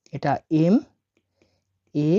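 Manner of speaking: ordinary delivery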